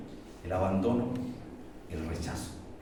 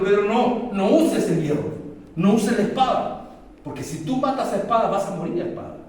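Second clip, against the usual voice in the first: louder, much higher commanding rebuke; forceful warning; 9 semitones higher, 7 dB louder than usual. Acted. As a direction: cry out the warning to Peter. Jesus forcefully warns Peter. (Jesús advierte a Pedro con fuerza, en voz alta)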